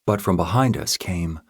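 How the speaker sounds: ordinary delivery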